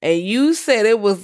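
ordinary talk, nothing marked

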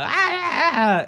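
high strangled performer's howl; persona's trademark howl. Acted. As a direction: let out the act's signature wail. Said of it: "people are expecting".

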